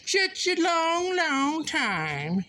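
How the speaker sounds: high, drawn-out croon on "long, long time"; playful singsong. Pretend. Missing a busy friend, playful high singsong.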